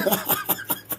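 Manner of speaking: high breathless squeal of laughter; squeaky laugh. Real, not acted. Host mid-pitch, bursting into a squeaky laugh.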